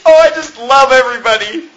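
high, syrupy mocking voice with laughs breaking in; mocking, sugary sing-song with giggles; gushy mock sentiment. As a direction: gush like a sappy do-gooder to ridicule them. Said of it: "It's not a gushy".

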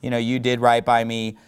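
low, subdued mock apology; mumbled-down imitation of a sorry king; a touch of acted sadness, pitched low; sad apology. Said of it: "Saul starts crying, and he's sad".

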